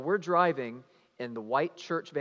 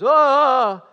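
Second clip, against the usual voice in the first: sudden high, loud "Ah!"; mock panicked scream; 14 semitones higher, 20 dB louder than usual. Chaperone tearing off her seatbelt on the bridge, mock panicked scream. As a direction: burst out with an imitated shriek. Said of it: "like that".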